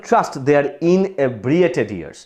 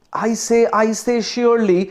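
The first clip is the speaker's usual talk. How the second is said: put-on higher, drawn-out Oxford-accented protest; lightly voiced Oxford English, pitched up; 6 semitones higher, much slower than usual. A narrator's reading. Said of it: "protested sir Mohan in his Oxford accent".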